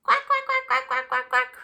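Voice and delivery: very high, squeaky little quacks; tiny ducklings quacking happily. Acted. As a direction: chirp it like baby ducks. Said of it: "her ducklings agreed".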